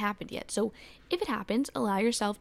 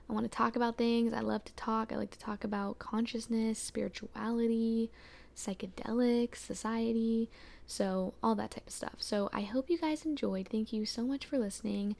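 ordinary delivery

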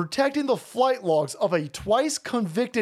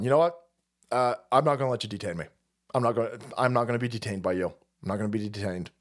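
lower, faster, a lightly voiced defiant detainee; 9 semitones lower, 3 dB quieter, faster than usual. Man refusing to be detained in Panama, fast low defiance. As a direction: rattle it off low as a stubborn man refusing arrest. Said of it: "sitting there going".

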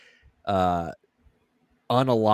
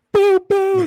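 high, loud cartoon shot noises; squeaky doo-doo gunfire; mock gunshots; 10 dB louder than usual. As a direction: pop off two silly shots. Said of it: "They're like".

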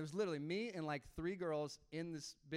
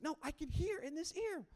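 high-pitched imitation of amazement; amazed excitement; 12 semitones higher than usual. Pastor imitating healed girl telling her friend, amazed excitement. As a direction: act out her astonished insistence.

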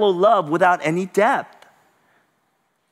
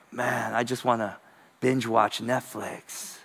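weary reluctance; 5 semitones lower, 6 dB quieter than usual. Retold or imitated. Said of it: "you're like".

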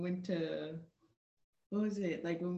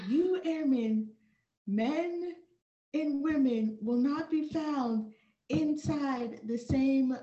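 higher and slow, each word set apart; stern instructor announcement; 6 semitones higher, 3 dB louder, slower than usual. Retold or imitated. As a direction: lay down the rule like a stiff sergeant.

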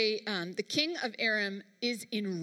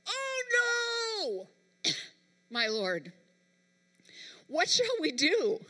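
high, drawn-out cry of dismay; panicked servant; 8 semitones higher, slower than usual. Acted. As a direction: act out the servant's alarmed wail. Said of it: "No, this is what he said".

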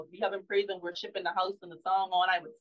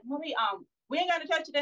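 imitating her daughter; 7 semitones higher than usual. Mother voicing daughter asking about church.

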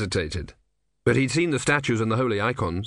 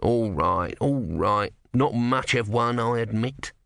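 usual speaking voice

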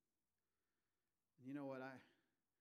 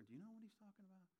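hushed and quick, a little higher; whispering; 6 semitones higher, 8 dB quieter, faster than usual. Retold or imitated. One disciple whispering to another, hushed and quick. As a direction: ask it under your breath, fast. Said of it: "can you just hear him whisper".

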